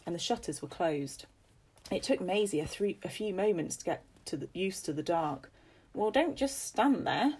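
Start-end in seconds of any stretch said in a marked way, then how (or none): 5.9-7.4 put-on high, impatient scolding from an old mistress; imperious old lady. Lady Agnes to Maisie at the door, imperious. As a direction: order the maid in, imperiously.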